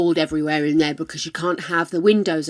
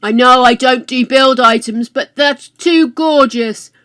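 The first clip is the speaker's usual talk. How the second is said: excited; 5 semitones higher, 9 dB louder than usual. Real, not acted. Thrilled by tapestries.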